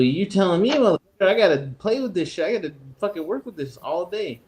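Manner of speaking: a touch of exasperation; retold annoyed reply. Telling the kid he must work with it, mock annoyed.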